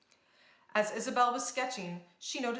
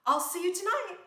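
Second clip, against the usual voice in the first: cheerful; 9 semitones higher, 6 dB louder than usual. A narrator's reading. Princess farewell to friends.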